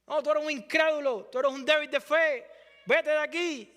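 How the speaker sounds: a hammed-up stern rejection; mock harsh rebuke. Preacher voicing a rejection Jesus never gave, mock stern.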